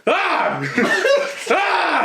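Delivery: high startled yelps waking up; mock startled cry. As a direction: jolt awake with a sharp yelp. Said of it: "he kept finding himself like".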